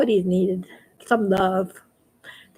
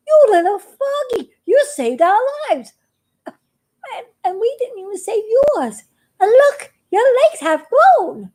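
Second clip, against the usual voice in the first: grateful toads; 9 semitones higher than usual. A narrator's reading. Grateful toads voiced by narrator.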